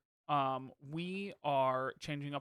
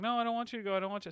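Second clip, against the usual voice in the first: high mock tearful plea; retold child's whine, pitched up; imitated crying child; 8 semitones higher, much faster than usual. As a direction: mimic a small son begging you to stay. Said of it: "he starts crying".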